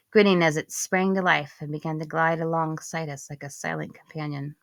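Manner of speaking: ordinary delivery, nothing marked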